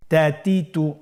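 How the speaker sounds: measured chant with held syllables; even, deliberate syllable recitation; recitation drill. Shown as a demonstration. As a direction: lead the class through joined syllables. Repeat-after-me spelling drill.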